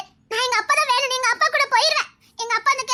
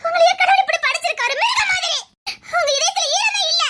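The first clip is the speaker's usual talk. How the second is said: high, tearful shouting; anguished accusation; 4 semitones higher than usual. Acted. Girl calling the man heartless, tearfully shouting. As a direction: hurl the reproach through sobs. Condemning his heartless refusal.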